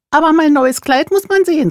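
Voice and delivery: vain peacock. A narrator's reading. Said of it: "sagte der Pfau".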